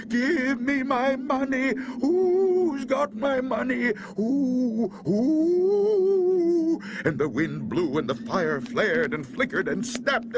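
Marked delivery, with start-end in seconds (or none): 0.1-6.8 high, drawn-out demand; haunting howl, rising on each who; wailing ghost voice. A narrator's reading. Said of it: "way off a voice cried".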